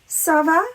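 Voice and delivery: rising French question "ça va"; question intonation. Shown as a demonstration. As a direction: ask "ça va" with a clear rise. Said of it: "raise our voice tells us that that is a question".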